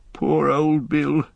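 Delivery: choked up, slow and sorrowful; choking voice. Acted. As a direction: grief catching in the throat. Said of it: "he said in a choking voice".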